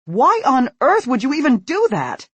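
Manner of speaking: high, loud exasperation; exasperated. A narrator's reading. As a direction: demand an answer. Mother exasperated.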